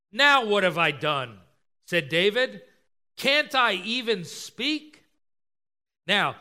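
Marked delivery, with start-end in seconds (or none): 0.1-1.4 louder, put-upon protest; exasperated younger brother answering back. Acted. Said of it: "He's almost exasperated". 3.2-5.0 slow, high protesting question; drawn-out plea to be heard; exasperated. Acted. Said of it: "He's almost exasperated".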